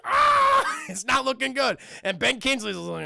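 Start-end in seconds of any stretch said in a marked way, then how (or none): 0.0-0.8 long, loud high scream; hammed-up burning-alive scream; mock agonized scream. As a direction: scream it in comic agony. Burning king screams in retelling.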